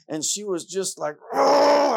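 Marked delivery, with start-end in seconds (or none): 1.3-1.9 loud high roar of mock toddler rage; long, exploding "ugh" of frustration; enraged child's growl. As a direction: imitate a furious little kid's growl. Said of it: "I could see the rage just building in her".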